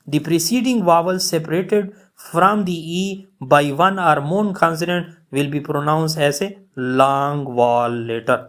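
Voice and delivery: reading the rule out word by word, very slowly; slow dictation. Shown as a demonstration. Teacher to students, reading the rule at dictation pace.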